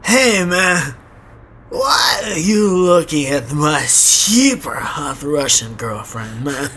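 slow, scratchy hammed-up Russian tough guy, a touch shouted; sketchy Russian accent. Imagined Russian neighbor warns the staring guy, in a hammed-up Russian accent. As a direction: lean into a sketchy Russian heavy warning him off. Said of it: "in a Russian accent".

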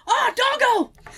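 excited. Retold or imitated. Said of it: "I went".

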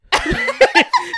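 shrieking high laugh. Real, not acted. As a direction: cackle up high. Laughing at story.

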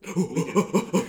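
high laughing reply. Real, not acted. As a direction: laugh while saying it. Laughing contradiction of co-host.

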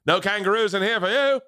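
put-on Australian accent. Comedian caught doing wrong accent leans into it, hammed-up Australian. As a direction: play up a cartoon Australian, pitch rising as you go. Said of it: "That's Australian".

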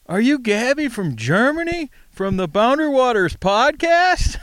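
very high, incredulous question; imitating starstruck fan. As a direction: play an astonished fan recognizing a celebrity. Retold fan recognizing Gabby.

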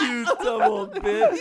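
high, laughing jeer; laughing insult. Real, not acted. Hosts laughing while insulting the pen pal, high and laughing. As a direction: spit it out through laughter.

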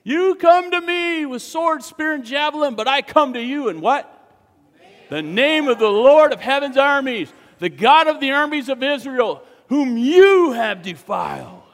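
loud, high, forced-manly declaration; trying to sound manly. Acted. David defying the giant, loud forced-manly proclamation. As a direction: boom it out like a boy proving himself. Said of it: "you're trying to sound manly".

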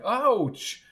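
high, sudden yelp; high-pitched cry of pain; pained ouch. A narrator's reading. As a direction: yelp as if jabbed in the ribs. Jabbed in the side.

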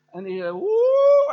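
high, loud, slow mock whoop; imitated loud whoop. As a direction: ham up her prophecy cry. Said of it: "hoorde je dan zo keihard". (nagedane luide uitroep)

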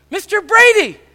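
ordinary delivery

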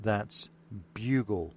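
ordinary talk

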